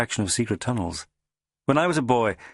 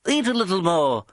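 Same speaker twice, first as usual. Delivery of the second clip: high, fussy mock concern; teasing little boss; 9 semitones higher than usual. A narrator's reading. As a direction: fuss over him from up high. Boss advises.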